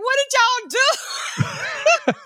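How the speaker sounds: hammed-up shocked mother yelling; shocked mom. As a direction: play the baffled mom, laughing through it. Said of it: "Her mom was like".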